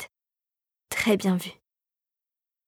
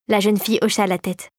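ordinary delivery, nothing marked

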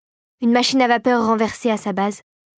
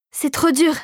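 higher voice, losing hope; distressed; 5 semitones higher than usual. A narrator's reading. Clara's hand is slipping from Philippe's, distressed higher voice. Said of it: "Ses pieds pendaient au-dessus d'un gouffre".

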